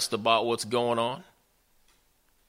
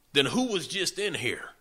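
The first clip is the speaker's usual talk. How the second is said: higher-pitched acted dismay; mildly alarmed question; 8 semitones higher than usual. Father realizing someone else came in, mildly alarmed. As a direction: imitate a shaken father, voice lifting. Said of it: "Isaac began to shake and tremble".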